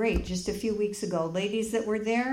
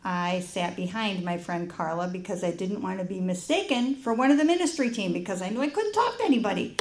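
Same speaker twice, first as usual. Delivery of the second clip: usual speaking voice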